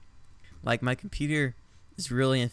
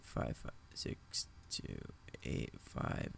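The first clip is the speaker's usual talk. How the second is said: whispering; 12 dB quieter, slower than usual. Real, not acted. Whispering digits while typing.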